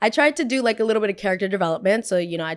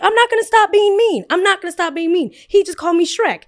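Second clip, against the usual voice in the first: very high, loud defiant shout; furious yell; 11 semitones higher, 8 dB louder than usual. Retold or imitated. Girl shouting at her friends after being called Shrek, very high loud defiant yell. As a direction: refuse to back down, yelling.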